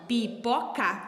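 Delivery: pipoca split into three separate sounds; syllable by syllable. Shown as a demonstration. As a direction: break pipoca into pi, po, ca. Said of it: "são três sonzinhos que eu vou ter que formar". (pipoca separada em três sonzinhos)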